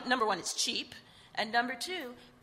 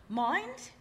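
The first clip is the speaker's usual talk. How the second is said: higher, rising British "Mind?"; a light British accent on "Mind?"; 9 semitones higher, 4 dB louder than usual. Retold or imitated. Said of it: "I'm trying to do her, her British accent".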